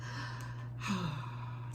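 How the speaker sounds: long unvoiced sigh out; wistful sigh at the moon; soft sigh. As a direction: let a slow dreamy sigh go. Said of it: "with a sigh".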